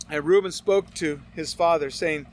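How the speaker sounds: normal talking voice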